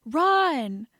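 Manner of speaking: urgent shout. A narrator's reading. Warning of approaching footsteps, urgent shout. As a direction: yell the warning.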